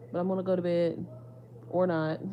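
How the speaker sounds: loud laugh at own joke. Real, not acted. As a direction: break into a big laugh. Joking about going to bed.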